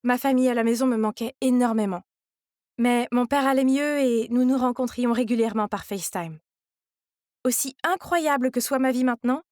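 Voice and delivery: ordinary delivery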